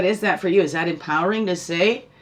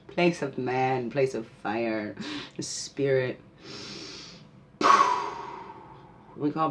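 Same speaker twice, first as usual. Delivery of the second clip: ceremonial invocation; 2 semitones lower than usual. Acted. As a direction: call the energies home like a prayer. Calls back energies from directions.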